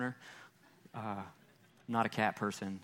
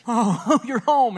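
much higher, much louder gushing dog voice; overjoyed dog; 10 semitones higher, 9 dB louder, slower than usual. Retold or imitated. Pastor voicing his dog greeting him at the door, ecstatic high squeal. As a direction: squeal it like a dog losing its mind with joy. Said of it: "he's like".

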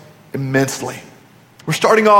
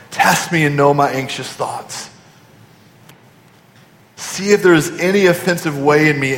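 prayer; 3 semitones lower than usual. Acted. Prays the verse aloud.

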